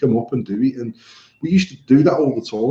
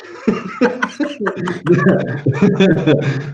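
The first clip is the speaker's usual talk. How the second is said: long open laugh, higher; big laugh; 5 semitones higher than usual. Real, not acted. Laughing at his own story for the listener.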